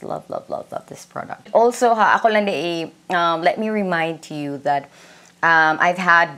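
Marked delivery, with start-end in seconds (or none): 0.0-1.5 hushed, whispered gushing; adoring whisper. Real, not acted. Vlogger swearing by a favourite product, adoring whisper. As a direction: whisper it like a devoted fan.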